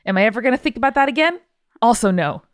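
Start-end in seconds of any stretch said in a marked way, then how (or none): none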